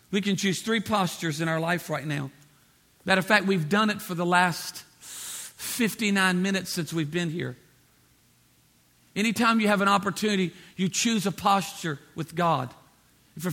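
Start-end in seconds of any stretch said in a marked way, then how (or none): none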